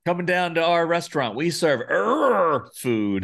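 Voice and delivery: playful mock advert voice; mock restaurant announcer. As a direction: ham up a restaurant welcome, stretching the "er". Mock restaurant pitch with growled er.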